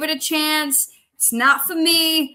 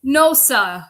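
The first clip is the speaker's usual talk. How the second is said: usual speaking voice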